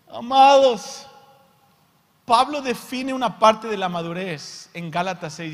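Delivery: high, loud sermon call; fiery preaching. Real, not acted. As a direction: call out to the beloved. Preaching at full force.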